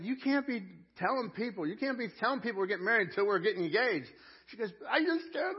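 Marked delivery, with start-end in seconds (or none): 0.0-4.1 raised-pitch retold objection; mock protest. Groom objects to fiancée telling people, mock protest. 4.9-5.6 very high, loud imitation of excitement; excited fiancée. Husband imitates fiancée unable to wait, excited. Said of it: "She's so excited".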